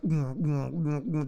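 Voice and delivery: hummed thumping dance beat; imitated club bassline. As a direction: hum a repeating club beat. Imitates the club's music beat.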